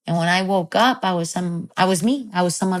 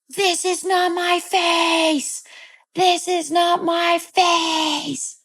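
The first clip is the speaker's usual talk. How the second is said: imitated yelling; 11 semitones higher, much slower than usual. Child at the bathroom mirror, imitated high slow yell. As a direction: re-enact a little kid yelling, every word stretched slow.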